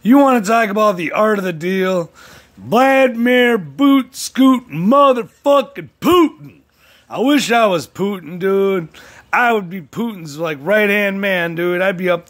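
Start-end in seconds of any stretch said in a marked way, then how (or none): 2.6-6.6 higher, louder, slow drawn-out hype; hyped name call. Acted. Vlogger hyping Putin's name, slow loud announcer call. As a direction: roll the name out like a ring announcer.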